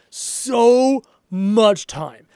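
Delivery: very slow, drawn out words; stretched out. Real, not acted. Streamer complains about long games, drawn out. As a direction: drag out how long it takes. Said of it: "stretched emphasis".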